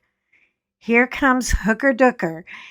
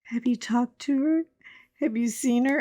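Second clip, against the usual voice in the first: imitating near tears; 4 semitones higher, 5 dB quieter than usual. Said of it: "practically in tears".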